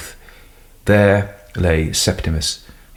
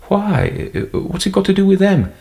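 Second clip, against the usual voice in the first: much higher anxious protest; alarmed; 13 semitones higher than usual. Acted. Son alarmed about police.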